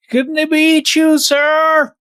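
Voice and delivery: high, polite fawning voice; mock deferential servant. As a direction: humor the famous poet with a high, fawning voice. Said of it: "So they'd talk like that".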